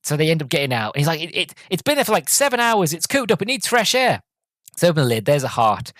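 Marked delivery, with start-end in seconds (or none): 1.2-4.2 high-pitched acted protest; urgent justifying. Driver talking passenger into it, high mock-urgent voice. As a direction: imitate him pleading his case, pitched right up.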